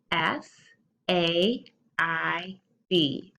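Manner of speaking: letters spaced out one by one; spelled out slowly. Shown as a demonstration. Teacher spelling to kids, slow and clear. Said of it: "spell it with me".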